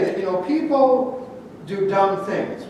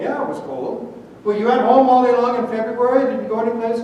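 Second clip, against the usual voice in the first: bright upbeat retort; 5 semitones higher, 4 dB louder than usual. Acted. Upbeat friend in acted dialogue.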